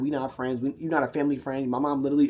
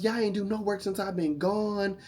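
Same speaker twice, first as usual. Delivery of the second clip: yelling; 6 semitones higher than usual. Retold or imitated. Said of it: "He came back yelling at us".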